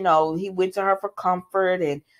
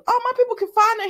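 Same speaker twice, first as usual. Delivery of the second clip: much higher, fast put-on Sonny; mock Sonny voice; 13 semitones higher, faster than usual. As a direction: toss it off fast in a high mocking voice. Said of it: "And he said".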